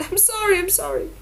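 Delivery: put-on a girl sobbing into her father's arms. A narrator's reading. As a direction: plead through sobs. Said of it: "she sobbed".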